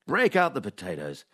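dry, deadpan storytelling read; comic story read. Acted. Host reading his scripted news-from-nowhere segment, deadpan. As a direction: read the absurd tale like a straight-faced report.

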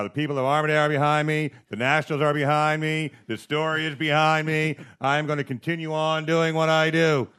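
Barnaby mock defiance. Mocking the resigning minister's defiant speech, hammed-up bluster. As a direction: puff up a defiant minister's speech, then deflate. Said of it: "he spent all last week saying".

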